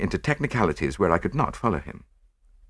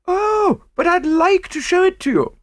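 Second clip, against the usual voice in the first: very high, eager insistence; won't take no for an answer; gushing matron insisting on showing her pearls; insistent rich lady; 4 dB louder than usual. A narrator's reading. Mrs Oppelson insists.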